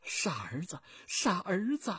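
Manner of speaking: whispered, then breaking into a loud cry; a mother's wail rising from a whisper. Acted. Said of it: "马秀英哭喊着".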